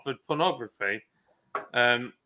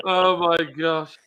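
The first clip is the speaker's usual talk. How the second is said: usual speaking voice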